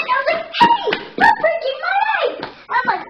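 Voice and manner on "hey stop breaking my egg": high, loud yell; shouting. Pretend. Child yells at the Easter bunny to stop, shouting. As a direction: yell at him to stop breaking it.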